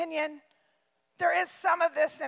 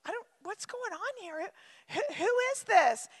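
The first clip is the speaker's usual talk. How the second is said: higher, hushed, puzzled voice; confused bystander; 3 semitones higher, 5 dB quieter than usual. Pretend. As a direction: ask like someone lost in the crowd. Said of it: "That's what the crowd does".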